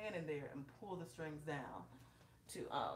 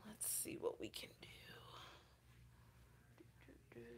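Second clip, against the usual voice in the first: whisper; 4 semitones higher, 12 dB quieter than usual. Real, not acted. Muttering to herself while setting up.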